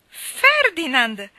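long, drawn-out high shout; anguished shout. A narrator's reading. Mally refusing the news of his death, shouting his name. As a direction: cry the name out at full voice. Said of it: "gridò di nuovo Mally".

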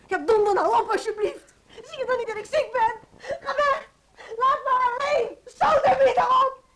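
high, very loud furious shriek; furious outburst. Acted. Sick woman driving her visitor away, furious screaming. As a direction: scream at them to get out. Zieke vrouw jaagt vriendin weg.

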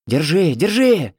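high, drawn-out urgent cry; boy's shout. A narrator's reading. Older boy holding on to another, high urgent shout. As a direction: shout it, stretching the last word. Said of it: "и кричал".

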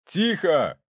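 high-pitched, sharp command for silence; judge calling order. A narrator's reading. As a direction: cut off the outburst with one word. Judge calls courtroom to order. (судья призывает к порядку)

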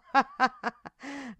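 loud, high villain's cackle; demonic laugh. A narrator's reading. Monster boasting of Satan's voice, loud evil laughter. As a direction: laugh loudly in evil mockery.

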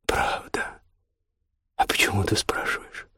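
soft, breath-only murmur; hushed. A narrator's reading. Man answering his lover's question, hushed. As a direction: answer under your breath, close to her.

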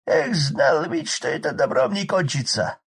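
high loud rough village man; hoarse peasant shout. Acted. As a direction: bellow over the roaring fire. Drunk Arkady voiced.

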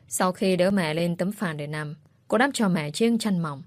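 ordinary delivery, nothing marked

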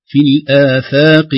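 slow, loud الآفاق with thin long "aa" vowels; light hamza and fa. Shown as a demonstration. Teacher modelling the correct reading, light hamza. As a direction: keep الآفاق thin until the qaf. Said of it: "ترقيق الهمزة بمدها والفاء".

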